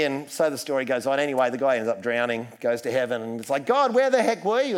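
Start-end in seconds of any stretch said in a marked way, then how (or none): 3.6-4.8 indignant complaint. Retold or imitated. Dead man complains to God.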